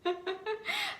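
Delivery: high embarrassed giggle; embarrassed laugh. Real, not acted. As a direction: laugh it off, self-conscious. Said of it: "I'm back to my British accent".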